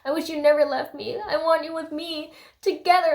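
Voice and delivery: whispered whimper rising to a high plea; hammed-up needy lovesick pleading; mock clingy pining. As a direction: whimper it, building in pitch and volume. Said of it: "they're very codependent on each other".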